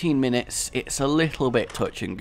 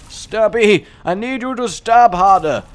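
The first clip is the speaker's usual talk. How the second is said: loud, high, drawn-out begging; loud mock plea to his knife-wielding character; mock pleading; 9 semitones higher, 8 dB louder, slower than usual. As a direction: beg the character for harder stabs, hammed up.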